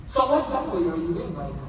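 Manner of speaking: ordinary talk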